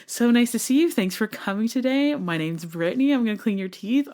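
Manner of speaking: high, bubbly receptionist voice; cheerful patient greeting; work voice. Shown as a demonstration. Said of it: "my work voice".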